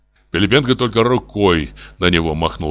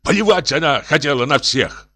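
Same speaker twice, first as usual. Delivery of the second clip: higher, exasperated retort; irritated; 8 semitones higher than usual. A narrator's reading. Professor cuts short the appeal, irritated. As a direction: snap back in annoyance. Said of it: "раздражённо сказал профессор".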